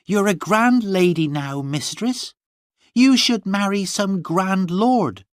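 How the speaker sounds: put-on high defiant girl's voice; a stubborn maid justifying herself; servant Gwenny.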